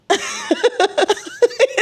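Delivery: high bursting giggle; caught-off-guard laugh; embarrassed giggle. Real, not acted. Matthew arrives while she's filming.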